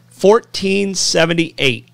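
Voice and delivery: drawn-out big reveal; slow dramatic reveal. Acted. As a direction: let the date land slowly. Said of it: "Are you prepared for this?".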